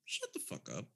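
hushed, understated dismissal. Shown as a demonstration. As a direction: say it barely aloud, no force at all. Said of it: "the tone could be very different".

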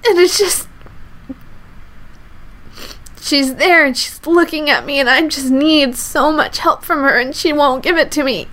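high, breaking voice still in tears; tearful. Real, not acted. Recalling her mother refusing help, still tearful. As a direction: push the words out through crying.

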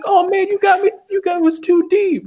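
high, whiny fake death moan; hammed-up dying. Killer faking his wound to his partner, high mock whine. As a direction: overplay a stab wound.

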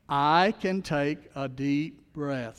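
very slow, each word drawn out; slowly, for the congregation to repeat; slow affirmation. Shown as a demonstration. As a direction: lead the room through it slowly. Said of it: "Say that phrase with me. Join me".

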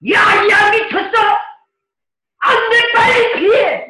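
high, loud alarmed yelling; urgent shout. Retold or imitated. Pastor acting a desperate warning to flee, shouting. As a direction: scream at them to get out now.

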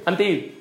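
"Anti" said quickly, syllables run together; casual, contracted "Anti"; quick, slurred. Shown as a demonstration. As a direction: blur "Anti" as in everyday speech. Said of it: "how do we say it quickly?".

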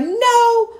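put-on very high kid's no; son's voice. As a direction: answer like a boy, squeaky. Son answering.

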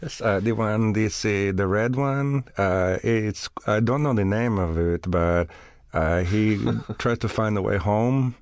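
halting, put-on Bulgarian accent; mock Bulgarian accent. Guest describing a film he can't name, mock Bulgarian accent. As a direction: search for English words in a Bulgarian accent.

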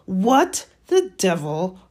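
loud, slow, furious; through gritted teeth. A narrator's reading. Uncle catching the boy, furious through gritted teeth. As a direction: space out each word through gritted teeth. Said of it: "said Uncle Vernon through gritted".

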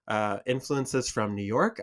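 normal talking voice

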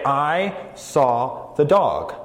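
slow, deliberate example sentence; a teacher's slow demo of plain subject-verb-object; normal word order. Shown as a demonstration. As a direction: spell it out slowly, in normal order. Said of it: "you would say".

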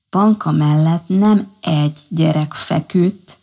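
usual speaking voice

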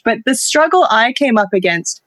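ordinary delivery

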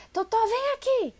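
high, loud angry yell at the dog; shouting at the dog. Shown as a demonstration. Owner yelling at runaway dog to come back, angry high shout. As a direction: bark the recall like a furious owner. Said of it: "A gente vai gritando".

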